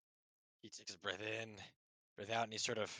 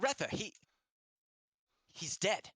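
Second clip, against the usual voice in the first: put-on upset stable boy; 9 semitones higher, 4 dB louder than usual. Stable boy reports death.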